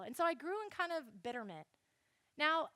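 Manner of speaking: ordinary talk, nothing marked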